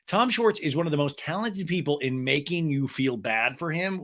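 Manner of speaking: ordinary talk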